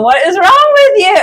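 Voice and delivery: high mock-angry old man; an exasperated, hammed-up telling-off; grumpy old man. Spirit guide scolding the speaker, playfully grumpy. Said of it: "that very grumpy old man going".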